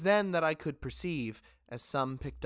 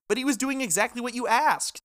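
protesting; 10 semitones higher, 4 dB louder than usual. Acted. Junk argues with Jackson.

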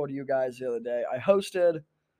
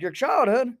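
put-on higher, incredulous country-accented therapist; country accent, pitched up in disbelief; 7 semitones higher, 3 dB louder than usual. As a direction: question it in a mock country accent. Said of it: "a country accent".

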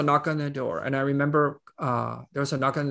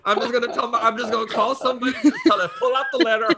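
higher and fast, laughing through it; laughing mock plan; 4 dB louder, faster than usual. CEO joking about calling the board chair, laughing. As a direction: giggle through the fantasy of quitting. Imagined quitting, laughing at herself.

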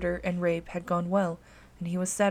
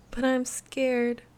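put-on tearful child; 6 semitones higher, much slower than usual. A narrator's reading. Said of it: "Anna began to cry once more".

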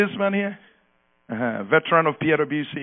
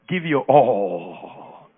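slow emphatic command; 5 semitones lower, much slower than usual. Shown as a demonstration. Preacher tells the church to say it slowly to a neighbor. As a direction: stretch out "Give your all" very slowly. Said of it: "Tell your neighbor".

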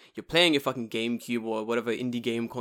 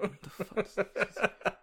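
baffled; 8 semitones higher, 6 dB quieter, much slower than usual. Retold or imitated. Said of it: "And I'm like".

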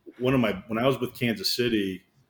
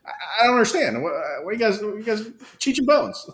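stammering, higher-pitched disbelief; exasperated coach; 9 semitones higher than usual. Retold or imitated. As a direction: imitate a coach at a loss with his team. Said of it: "he starts yelling at everybody".

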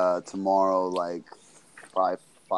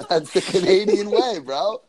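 laughing it out, much higher; 11 semitones higher, 3 dB louder than usual. Real, not acted. Guest laughing at the sex joke, talking through laughter. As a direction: squeeze the words through a big laugh.

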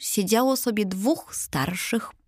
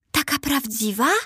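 delighted; 5 semitones higher than usual. A narrator's reading. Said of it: "ucieszył się Arbus".

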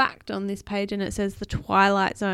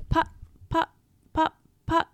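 short, spaced engine putts; sputtering engine putts; 9 semitones higher, 5 dB louder than usual. A narrator's reading. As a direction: imitate a motor cutting out putt by putt. Imitated failing engine noise.

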